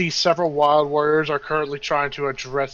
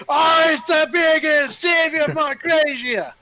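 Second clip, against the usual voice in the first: loud, high acclaiming roar; crowd shouting; 13 semitones higher, 5 dB louder than usual. Acted. Crowd hails their returning saviour, high excited shouting. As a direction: shout it like a whole mob hailing a hero. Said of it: "suddenly everyone's just shouting".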